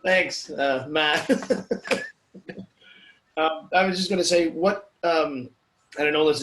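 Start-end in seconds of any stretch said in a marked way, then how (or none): 1.3-2.1 breathy laugh. Real, not acted. Laugh after greeting Matt.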